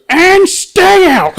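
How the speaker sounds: high, loud, slow bellow; parting shout. Retold or imitated. Grandfather shutting the door on the bear, bellowing. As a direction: slam the door with a big yell. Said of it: "and goes".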